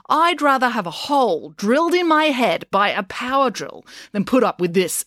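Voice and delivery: high-pitched, emphatic student complaint; exasperated protest. A narrator's reading. April objects to the whole class, exasperated.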